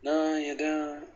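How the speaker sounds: slow drawn-out Yorkshire "no"; a mock Yorkshire reply, stretched out slow; put-on Yorkshire accent. Gamer answering a friend in a hammed-up Yorkshire accent. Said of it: "I'm speaking in a Yorkshire accent".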